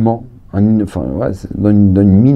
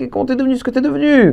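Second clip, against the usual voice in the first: high, quick incredulous question; fast, high-pitched amazement; acted astonishment; 14 semitones higher, faster than usual. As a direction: blurt it out in mock disbelief. Acted astonished old classmate.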